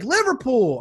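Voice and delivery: put-on high, loud child's answer; excited little girl. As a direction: pipe up like a small kid. Daughter's answer from back seat.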